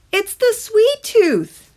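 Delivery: high, slow little tooth creature; drawn-out squeaky voice of a talking tooth; eerie tiny tooth speaking slowly; sweet tooth voice. A narrator's reading.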